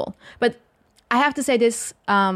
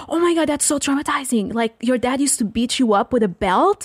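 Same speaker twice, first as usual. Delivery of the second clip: gasping, higher-pitched imitation of horrified people; mock shock; 4 semitones higher than usual. People reacting to her dad's belt story, mock shocked. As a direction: act scandalized, pitch climbing on the question.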